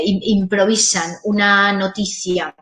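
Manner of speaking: ordinary talk, nothing marked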